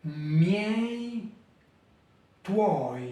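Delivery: Miei, tuoi, each one gliding syllable; Italian triphthongs repeated. Shown as a demonstration. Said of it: "Je répète".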